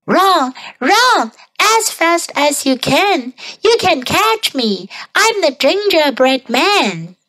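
high, sing-out taunt; taunting gingerbread man. A narrator's reading. Gingerbread man taunts the chasing old couple, high-pitched. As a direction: tease the chasers in a high voice. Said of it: "laughed and said".

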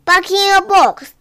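firm order. Acted. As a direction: send a naughty pet to bed firmly.

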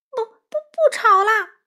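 high, loud frightened stutter; halting, panicky cry; stammering. A narrator's reading. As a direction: stammer the promise in terror, drawn out. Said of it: "欢仔结结巴巴地说".

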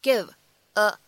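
"give", short pause, then "a"; unlinked "give a"; words kept apart. Shown as a demonstration. Separate words before linked demo.

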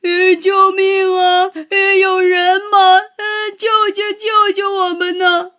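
high, loud wailing cries for help; animals wailing. A narrator's reading. Three trapped animals wailing for rescue, high and loud. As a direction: bawl for help, tucked under the giant's arm. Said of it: "三个动物嚎啕大哭起来".

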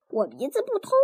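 ordinary delivery, nothing marked

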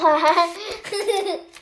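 real giggle; tickled chuckle; amused laugh. Real, not acted. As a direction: laugh at your own silly wailing. Reader amused by the playful wailing.

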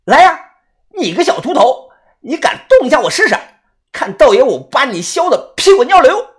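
cocky high-pitched threat; loud challenge. A narrator's reading. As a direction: dare him loudly in a high voice. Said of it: "大声地说".

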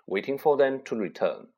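ordinary delivery, nothing marked

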